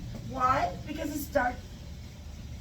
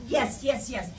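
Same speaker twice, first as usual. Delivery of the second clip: ordinary delivery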